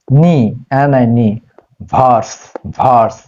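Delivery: "নি" and "ভার্স" said as separate syllables; syllable demo. Shown as a demonstration. Teacher splitting universe for students who find it hard, syllable demo. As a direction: sound out "নি", spell it, then "ভার্স" twice, with pauses. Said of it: "এটাকে syllable করে নাও".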